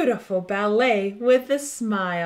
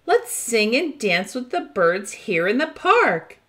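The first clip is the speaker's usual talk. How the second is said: ordinary delivery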